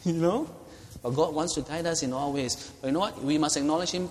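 normal talking voice

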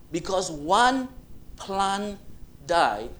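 punched, separated words, slow in places; emphatic plea. Acted. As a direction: hammer each word home. God's final plea to Jonah.

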